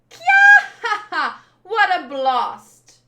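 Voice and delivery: very high, loud laughing shriek; gloating laugh. Acted. Villainess gloating after the blast, a high laughing shout. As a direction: laugh through it, delighted by the blast.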